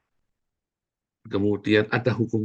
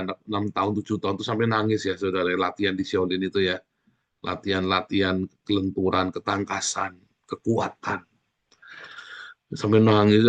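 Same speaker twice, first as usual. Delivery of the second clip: normal talking voice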